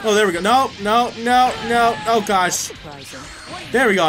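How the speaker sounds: normal talking voice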